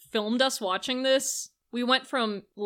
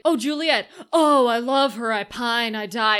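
loud mock swooning; hammed-up instant infatuation; lovesick Romeo; 6 dB louder than usual. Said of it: "he's like".

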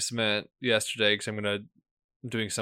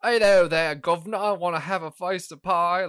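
hammy fake Cockney, high and loud; bad Cockney accent; 7 semitones higher, 4 dB louder than usual. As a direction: overdo a cheap British game accent. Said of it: "it's just terrible accents all around".

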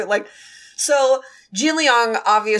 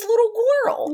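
cutesy voice; 13 semitones higher than usual. Retold or imitated. Voicing cute ghost name.

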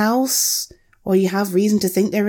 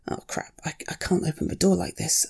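frustrated whisper; 4 semitones lower, 8 dB quieter than usual. Real, not acted. Hands full, talking to herself.

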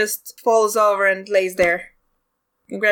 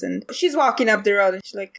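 normal talking voice

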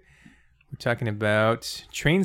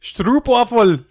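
high, loud, drawn-out holler; mock accented shout; 11 semitones higher, 9 dB louder, much slower than usual. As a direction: bellow it slowly like a cartoon foreigner. Yelling accent caricatures in turn.

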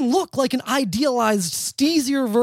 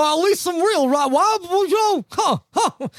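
put-on old rocker voice; 7 semitones higher than usual. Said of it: "They'll go".

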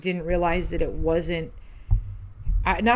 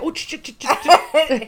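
high stammering mock woman, laughing; laughing impression of a stammering woman; imitating flustered woman; 8 semitones higher, 7 dB louder than usual. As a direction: stutter it out like a flustered old lady. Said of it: "And she's like".

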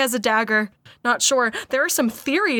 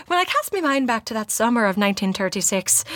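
practised Irish accent from drama class; recited Irish accent; Irish monologue accent. Shown as a demonstration. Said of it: "that was what they made us do our monologue in".

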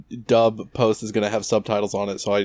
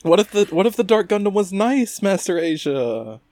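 mock naive plea; 9 semitones higher than usual. Wong pleading with Master Asia, mock naive. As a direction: plead up high, stretching the name.

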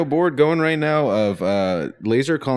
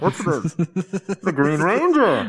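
silly high voice; 6 semitones higher, much slower than usual. Pretend. Mocking Green Ranger reveal.

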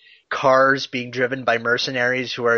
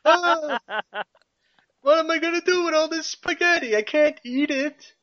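cartoon chef. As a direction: wail it like a panicked cartoon cook. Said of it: "cartoon chef who's made too much spaghetti".

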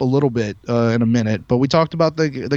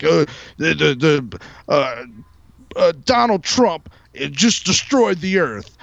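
slow, stumbling mock idiot voice; lightly voiced halting idiot; dumb mockery; slower than usual. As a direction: play the halting know-nothing, lightly. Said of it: "their mother is out there like".

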